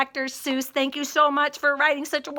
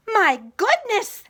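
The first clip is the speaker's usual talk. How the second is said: louder, higher amazed cry; shouting; 5 semitones higher, 5 dB louder than usual. Acted. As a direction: exclaim in wonder. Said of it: "they shouted".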